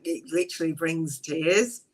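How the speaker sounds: ordinary talk, nothing marked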